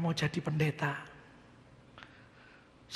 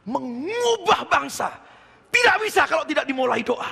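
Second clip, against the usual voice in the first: fervent exhortation; 7 semitones higher, 9 dB louder than usual. Real, not acted. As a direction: drive the point home to the crowd. Preacher exhorting congregation. (berkhotbah dengan suara keras dan lantang)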